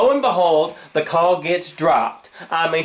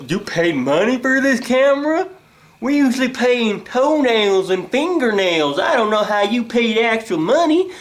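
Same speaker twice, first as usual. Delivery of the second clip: higher, mock-baffled employee; clueless store staff; 5 semitones higher than usual. Staff puzzled by a customer paying money, mock clueless. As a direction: act dumbfounded in a raised voice. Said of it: "all of them seem clueless".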